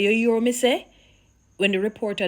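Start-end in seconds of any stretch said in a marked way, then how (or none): 0.0-0.9 mock challenge. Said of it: "You hear him say".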